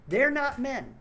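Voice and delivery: loud, angry declaration; getting angry. Real, not acted. Ranting to his viewers about politicians, loud and angry. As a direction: spit it out in real anger. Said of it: "y'all don't like me 'cause I'm getting angry".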